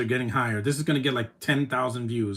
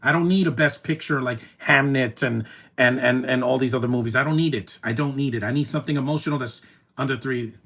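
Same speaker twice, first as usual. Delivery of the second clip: usual speaking voice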